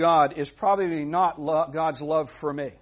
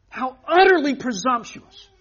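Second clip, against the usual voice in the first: loud, high, indignant cry; outraged exclamation; 8 semitones higher, 8 dB louder than usual. Real, not acted. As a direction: hurl it out at the congregation, higher and louder. Preacher outraged, building emphasis.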